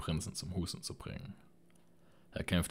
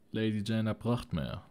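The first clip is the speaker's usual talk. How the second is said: purring; 6 semitones higher, 5 dB louder than usual. Acted. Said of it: "säuselte Kel'Thuzad".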